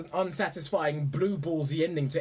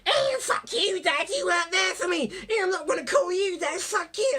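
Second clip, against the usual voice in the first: mock bratty son; 14 semitones higher than usual. Edward yelling at his dad, very high mock tantrum. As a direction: squeal it like a bratty teen. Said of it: "And he goes".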